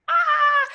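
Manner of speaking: sudden high panicked shriek. Retold or imitated. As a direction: yelp in mock alarm. Panicked at first dance call.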